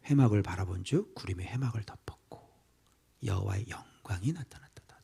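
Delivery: hushed whisper of the verse; whispered Bible reading; whispered scripture. Acted. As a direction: breathe the words in awe.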